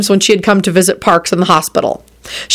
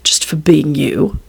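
slow, hushed reply; intimate whisper; 3 semitones lower, slower than usual. Acted. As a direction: murmur it slowly to a lover. Murmured reply after a kiss.

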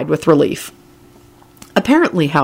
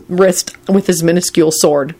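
ordinary delivery, nothing marked

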